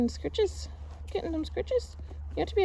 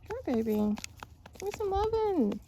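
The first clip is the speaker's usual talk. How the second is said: coaxing pet; 8 semitones higher than usual. Real, not acted. Coaxing a pet onto lap.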